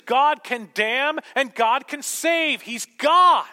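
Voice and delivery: high, loud, slow proclamation; preaching at full force. Acted. Preacher to congregation, declaring God's sovereignty, loud and slow. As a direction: thunder it from the pulpit, slowly.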